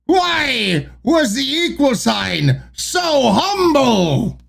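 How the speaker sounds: slow, high pirate joke read; put-on pirate voice with drawn-out words. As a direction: tell the joke as a hammy buccaneer. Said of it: "read the joke like a pirate".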